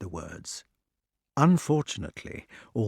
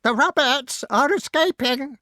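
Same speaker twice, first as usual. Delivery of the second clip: high piercing scream, louder; screaming crow; 5 dB louder than usual. A narrator's reading. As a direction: shriek the alarm. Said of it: "screamed the crow".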